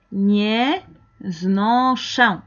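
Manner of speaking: slow syllables with gaps between; broken into parts. Shown as a demonstration. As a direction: model it piece by piece. Modela la palabra por partes. (pronunciado despacio, por partes)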